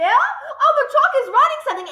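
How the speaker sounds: high frantic squeal; excited scream. A narrator's reading. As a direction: shout it in wild alarm. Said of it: "screamed Nigel".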